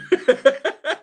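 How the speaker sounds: high burst of laughter; laughing. Real, not acted. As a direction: laugh out loud. Laugh at chat joke.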